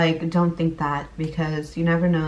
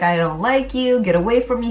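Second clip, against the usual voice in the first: higher, snappy brush-off, lightly acted; mock cold rejection; 5 semitones higher than usual. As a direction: push the husband away in a half-joking retold snap. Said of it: "I was just like".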